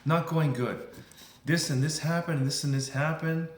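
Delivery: complaining customer. Acted. Customer answers how the day is going, complaining. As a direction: act out a put-upon customer venting.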